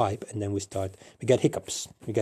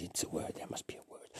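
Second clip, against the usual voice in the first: whispered aside; 4 semitones lower, 18 dB quieter, faster than usual. Real, not acted. Muttered aside to himself.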